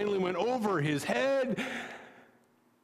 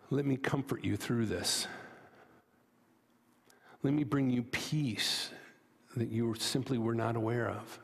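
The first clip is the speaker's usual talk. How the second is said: tender voice of God; 7 semitones lower than usual. Acted. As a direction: speak like a loving father holding a child close. God's voice, tender promise.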